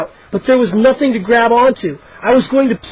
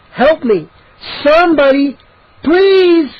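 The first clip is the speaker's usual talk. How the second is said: rising, louder pleas for help; panicked calls; 8 semitones higher, 4 dB louder, slower than usual. A narrator's reading. Trapped boy calling out in rising panic. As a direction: climb higher with each plea.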